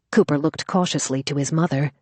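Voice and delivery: usual speaking voice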